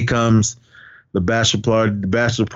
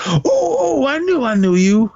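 excited recognition; 12 semitones higher than usual. Retold or imitated. Retelling a man recognizing someone, excited. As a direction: pitch it way up, hammed-up recognition.

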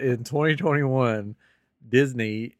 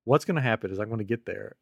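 ordinary talk

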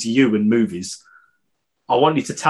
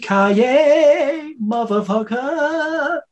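slow, much higher hammed-up catchphrase; movie catchphrase; 12 semitones higher, much slower than usual. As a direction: drag out the action hero line. Quoting Die Hard catchphrase.

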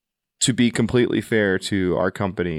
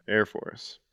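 whisper; 7 dB quieter than usual. Acted. Echoing the joke in a whisper.

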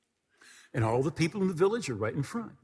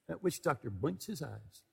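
normal talking voice

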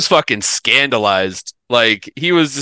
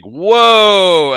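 awed whoa; 8 semitones higher, 7 dB louder than usual. Retold or imitated. Crowd reacting in awe.

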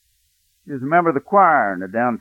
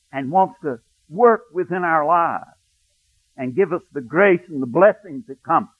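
fervent preaching; 7 semitones higher, 5 dB louder than usual. Acted. Preacher urging his listeners toward God, fervent and rising. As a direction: lift the congregation with rising fervor.

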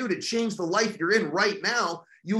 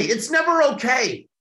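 forceful shout; 5 semitones higher, 5 dB louder than usual. Real, not acted. Speaker to audience, shouting down every excuse. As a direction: shout it, with full weight on never. Repeats his own line louder.

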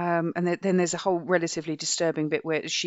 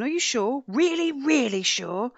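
pressing interviewer; 6 semitones higher, slower than usual. Retold or imitated.

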